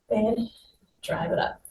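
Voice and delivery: "drive it up" whispered, almost no voice; breathy near-whisper. Shown as a demonstration. Coach whispering the cue during knee drives.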